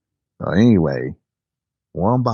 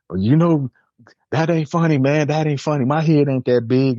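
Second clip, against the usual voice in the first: high-pitched mock whine of protest; protesting friend; 9 semitones higher than usual. Friend objecting to teasing about his head, high-pitched mock protest. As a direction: imitate him protesting, pitched way up. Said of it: "And he's like".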